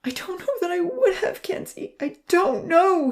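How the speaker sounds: grieving. A narrator's reading. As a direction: push the words through grief. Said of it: "he grieved".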